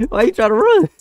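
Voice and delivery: high, loud teasing squeal; mocking taunt. Gamer taunts fleeing player in a high mocking voice. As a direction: squeak it at the runner like a cartoon.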